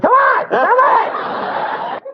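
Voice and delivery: high, loud yell; shrill-pitched, full-voiced calling out; acted shout. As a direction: holler it at passers-by. Acting out shouting outside subway entrance.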